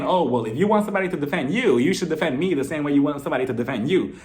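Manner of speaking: usual speaking voice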